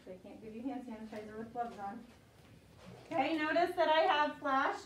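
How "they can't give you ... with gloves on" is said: quiet aside. Real, not acted. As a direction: drop the voice low for a private aside. Teacher's aside to late student.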